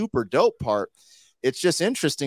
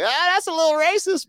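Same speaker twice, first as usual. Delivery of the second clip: much higher, squeaky mock aside; mock reaction voice; 3 dB louder than usual. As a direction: pitch way up in a joking gasp. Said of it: "I was like".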